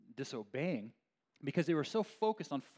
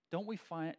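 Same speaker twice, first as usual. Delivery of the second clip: ordinary talk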